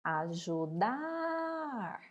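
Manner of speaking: "Ajudar" called slowly with a long held DAR; calling out. Shown as a demonstration. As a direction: call the word "Ajudar", stretching the last syllable DAR. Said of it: "vamos chamar a palavra?". (chamando "Ajudar", com o DAR bem comprido)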